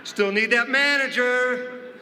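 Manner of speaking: slow, high call for the manager; drawn-out cashier call. Retold or imitated. Cashier still waits for manager, slow high call. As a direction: stretch the plea out slowly.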